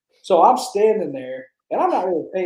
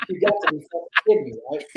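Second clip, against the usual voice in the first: disbelief; 9 semitones higher, faster than usual. Retold or imitated. Retold disbelief at cake.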